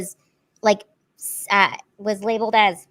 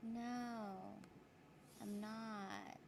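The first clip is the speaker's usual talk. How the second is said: cave echo; 29 dB quieter, much slower than usual. Real, not acted. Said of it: "You sound really quiet".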